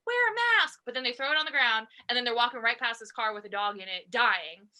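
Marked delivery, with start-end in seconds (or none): 0.1-0.8 high, pushy mimic of a preachy ranter; mock them, nagging and a bit louder; a touch of a scolding busybody. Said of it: "they're the ones ranting about".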